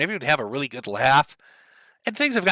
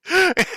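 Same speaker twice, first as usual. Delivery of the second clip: sudden squeaky laugh; real high-pitched giggle; high burst of laughter; 3 dB louder than usual. Real, not acted. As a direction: laugh out loud, high and short. Reactor laughs at clip.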